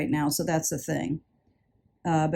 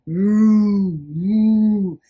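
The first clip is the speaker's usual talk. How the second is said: loud mechanical woo noises; mock polishing machine whir; 5 dB louder than usual. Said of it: "I hear the machine".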